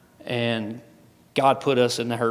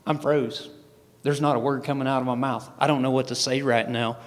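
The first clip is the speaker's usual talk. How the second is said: usual speaking voice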